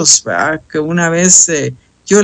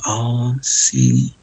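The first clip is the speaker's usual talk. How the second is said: much softer, breathless meek whisper of surrender; sheepish whisper; 6 semitones lower, 6 dB quieter than usual. Retold or imitated. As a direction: caught out, answer in a small whisper. Sheepish reply after God's rebuke. (susurro avergonzado y sumiso)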